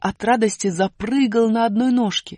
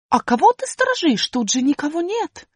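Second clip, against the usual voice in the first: высоко и громко, недоумевая; маленькая героиня возмущённо спрашивает; высокий удивлённый голос; 9 semitones higher, 4 dB louder than usual. Acted. Вопрос сторожу. (высокий удивлённый голос героини)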